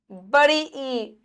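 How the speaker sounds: ordinary talk, nothing marked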